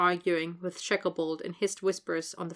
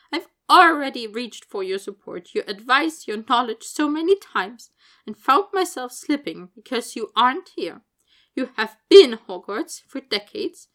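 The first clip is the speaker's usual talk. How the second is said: higher, louder voice full of grief and pleading; grieving farewell speech; 5 semitones higher, 8 dB louder than usual. Acted. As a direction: speak to a lost colleague, pleading and raw.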